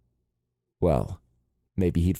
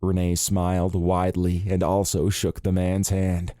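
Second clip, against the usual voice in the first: usual speaking voice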